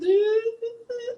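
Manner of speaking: very high falsetto squeal; silly high-pitched vocal noise; playful squeal. Pretend. Playful squeal amid voice-acting talk.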